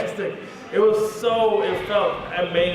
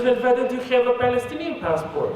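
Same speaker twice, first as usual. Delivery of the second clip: put-on higher-pitched German-accented official; German officer; 5 semitones higher than usual. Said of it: "I'm in Germany".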